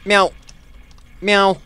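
high, playful cat meows; cat meowing. Pretend. Player idly meowing through the game, high cat voice.

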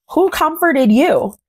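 usual speaking voice

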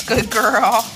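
loud, cooing praise; dog praise. Real, not acted. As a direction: praise the dog with full-voiced fondness.

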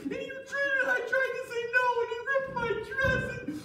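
very high mock-tearful accusation; hammed-up wailing. Wife falsely accuses Joseph to guards, fake wailing. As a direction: wail each accusation in a squealing fake cry. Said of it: "He was a really good actor".

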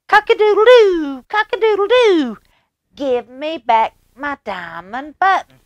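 very high, loud crowing cock-a-doodle-doo; shrieking rooster demanding his button back. Acted. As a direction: crow it out like a bold rooster. Rooster crows at king.

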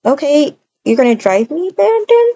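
ordinary delivery

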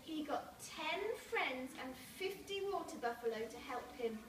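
usual speaking voice

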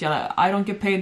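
usual speaking voice

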